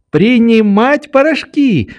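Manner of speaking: "принимать порошки" high and quick, falling at the end; girl chiming in. A narrator's reading. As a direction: finish his phrase "принимать порошки" as the child. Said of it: "подхватила Нелли".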